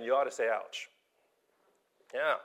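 ordinary talk

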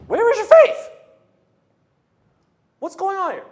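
very high, very loud shouted rebuke; furious rebuke. Acted. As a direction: blast the disciples at full voice. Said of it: "Why is Jesus like that?".

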